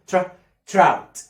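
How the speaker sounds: ترا then تراوت blended as trout; blending trout. Shown as a demonstration. Teacher blending تراوت for the class. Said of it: "بقول عليها ترا".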